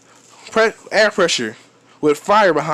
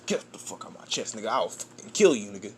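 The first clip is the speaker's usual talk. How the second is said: hushed threat; 7 semitones lower, 10 dB quieter, faster than usual. Real, not acted. Reacting to in-game attacker.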